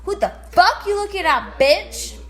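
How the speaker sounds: loud, high, confrontational; aggressive snap. Acted. Telling off a rival woman, a loud angry snap. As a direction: get in her face.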